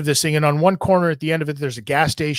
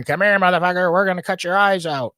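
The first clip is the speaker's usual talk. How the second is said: higher, menacing imagined voice from his paranoid trip; hallucinated threat; 5 semitones higher than usual. Retold or imitated. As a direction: voice the threatening thugs Kyle hears in his head. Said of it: "in his head, he's hearing".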